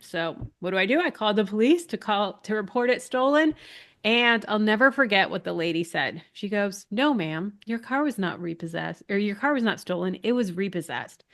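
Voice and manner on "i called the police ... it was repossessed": ordinary talk, nothing marked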